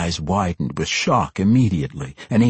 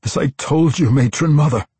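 high, pleading insistence; desperate; 8 semitones higher, 4 dB louder than usual. A narrator's reading. Tosin answers the angry matron, desperate. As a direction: plead to be believed, pitch raised. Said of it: "he said desperately".

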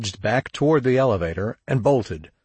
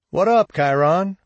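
high, louder friendly call, lifting at the end; amicable greeting; 9 semitones higher, 5 dB louder than usual. A narrator's reading. Shug to a man he has known for years, amicably. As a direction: greet an old acquaintance cheerily. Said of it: "said Shook amicably".

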